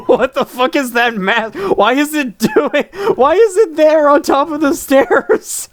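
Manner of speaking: nervous laughing, rising in pitch; scared horror game streamer. Real, not acted. Startled by creepy hotel figure.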